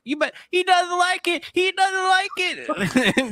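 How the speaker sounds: high, slow, sing-song mocking voice; mock Jack Baker taunt. Friend acting out the dinner scene, high slow mock taunt. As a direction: draw it out like a gloating madman.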